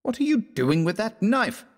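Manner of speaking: high-pitched startled question; demanding. A narrator's reading. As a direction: demand an answer, voice pitched right up. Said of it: "demanded Fallowby".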